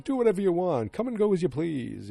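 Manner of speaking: loud, high-pitched mock exasperation; imitated angry dismissal, voice shooting up; pissed-off retort. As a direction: act out a fed-up husband throwing up his hands, loudly. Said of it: "she got all pissed".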